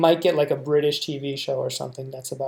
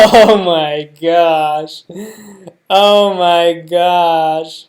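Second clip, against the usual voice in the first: very slow, drawn-out, louder oh my gosh; amazed laughing gasp; 9 dB louder, much slower than usual. Real, not acted. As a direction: savor the disbelief, half laughing. Laughing at show's casting.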